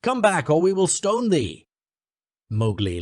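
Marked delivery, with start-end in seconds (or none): 0.0-1.7 high, loud threat; higher, louder angry yell; crowd shouting. Acted. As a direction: threaten the mother back. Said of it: "shouted the crowd".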